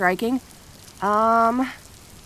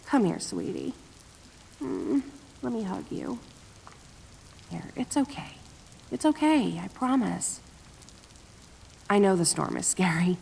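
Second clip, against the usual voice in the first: much softer, hushed reassurance; tender comfort; 6 dB quieter than usual. Acted. Kitsune hugging her friend scared of thunder, soft soothing. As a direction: soothe them like a frightened child.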